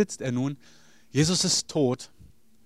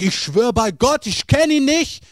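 loud, much higher, desperate swearing denial; cursing denial; 9 semitones higher, 6 dB louder, slower than usual. Acted. Peter cursing to the third accuser, shouting. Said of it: "fing an, sich zu verwünschen und zu verfluchen".

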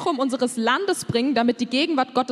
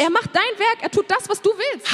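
much higher, raised indignant cry; indignant protest; 8 semitones higher than usual. Retold or imitated. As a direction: interpreter echoing David's indignant protest. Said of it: "Und er ist wütend und sagt".

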